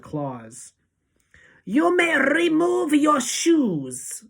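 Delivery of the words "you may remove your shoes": barked order, high and drawn out; Grand High Witch. A narrator's reading. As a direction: bark it harshly, rolling the R. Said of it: "barked the Grand High Witch".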